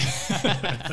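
high burst of laughter; loud laugh. Real, not acted. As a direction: let out a big laugh.

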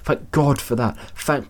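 high-pitched, fervent thanks; relieved. A narrator's reading. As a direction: pour out relief, then break off. Mother relieved, then breaks off.

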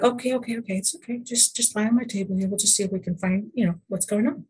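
calm a frightened client gently; hushed therapist calming a scared client; reassuring. Retold or imitated. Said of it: "I'm like".

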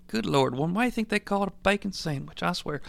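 fast, very soft grumble; grumbled aside. Acted. Dad to himself about his wife, soft exasperated aside. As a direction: grumble it under your breath.

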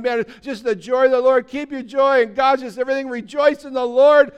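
loud, high joyful exclaiming; all excited. Pretend. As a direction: burst out with delight about joy. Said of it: "like, all excited".

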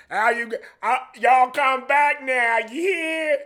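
very high, slow, hammed-up Southern drawl; exaggerated mock Southern twang; dumb Southern accent. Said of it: "when we do a Southern accent".

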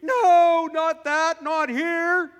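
high, loud cry of refusal; shrill protest. Retold or imitated. Scene: woman jumps up rejecting the word, loud high cry. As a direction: shout the woman's objection. Woman retold standing up, objecting.

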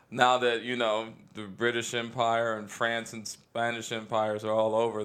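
normal talking voice

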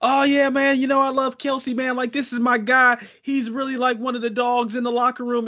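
high-pitched mock quarterback interview; Pat Mahomes. Quarterback praising his teammate on the microphone, high mocking imitation. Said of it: "Pat Mahomes, he get on the microphone".

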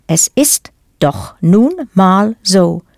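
slow, with a pause between words. Shown as a demonstration. Teacher breaks down a German phrase for learners, word by word. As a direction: separate "doch", "nun", "mal", "so" for a learner.

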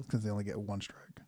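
slow whispered aside; whispering. Real, not acted. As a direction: whisper it to the table. Quiet aside at the table.

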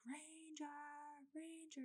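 high, soft sing-song calling of a name; calling the dog. Retold or imitated. Mom from car window calling the runaway dog, high sing-song. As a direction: call out a lost dog's name, high and gentle.

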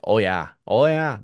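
loud Canadian "Oh, yeah"; Canadian accent, punchy and loud. Shown as a demonstration. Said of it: "re-say it in a Canadian accent".

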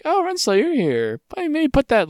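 high, mock-sweet greeting; mock polite. Scientist nervously greeting Renslayer, high mock sweetness. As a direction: greet her in a sudden high fake voice. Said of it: "He's like".

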